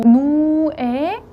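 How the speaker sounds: 누에 drawn out very slowly; stress on 누에. Shown as a demonstration. Teacher showing where the stress falls, drawing out 누에. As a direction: stretch 누에 long and lean on it. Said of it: "강세가 좀 가죠".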